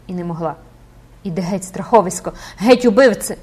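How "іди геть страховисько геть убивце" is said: loud furious "геть", rising on the second; angry and afraid. A narrator's reading. Esmeralda drives the priest away, angry loud cry. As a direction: drive him off in rage and fear. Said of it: "тремтячим і низьким від гніву й жаху".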